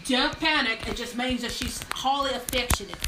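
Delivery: broad, put-on Australian accent. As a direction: play a cheerful Aussie mate. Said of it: "I'll do my Australian accent".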